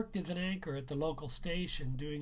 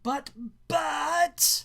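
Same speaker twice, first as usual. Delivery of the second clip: high, loud mocking whine of the student; teacher mocks the student's protest in a whiny voice; 11 semitones higher, 9 dB louder than usual. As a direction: ape the boy's stammer, loudly. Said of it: "Mister Sphincter mocked".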